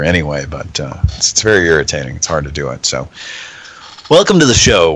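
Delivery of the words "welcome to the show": loud welcome. Real, not acted. Host opening the show.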